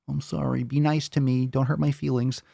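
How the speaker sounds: ordinary talk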